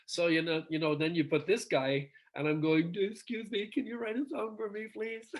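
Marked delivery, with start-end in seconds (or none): 2.9-5.3 higher, softer, timidly begging; mock meek plea. Singer mocks himself asking a busy songwriter for a song, meekly pleading. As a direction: ask it like a humble fan begging a favour.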